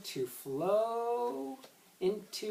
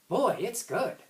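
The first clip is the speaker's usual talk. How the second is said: delighted; 6 semitones higher, 6 dB louder, slower than usual. Acted. John delighted by chocolate taste.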